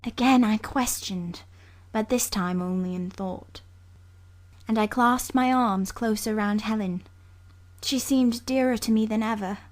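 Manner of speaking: ordinary delivery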